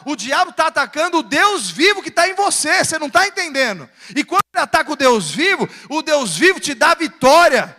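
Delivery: impassioned pulpit proclamation; forceful preaching. Acted. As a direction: drive it home to the congregation with swelling force.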